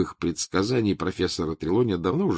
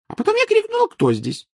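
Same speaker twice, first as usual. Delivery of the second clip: Trelawney voice; 6 dB louder than usual. Acted.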